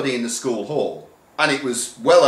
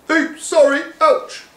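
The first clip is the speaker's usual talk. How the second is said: high, yelped apologies; squeezed in a packed room; child yelping in the crush; squashed pupil; 3 dB louder than usual. Acted. Crushed crowd voices.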